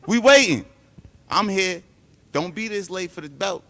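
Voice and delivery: loud, high taunting shout; calling out. Real, not acted. Fighter shouting for his absent rival to show up, loud taunt. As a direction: yell for him to show up.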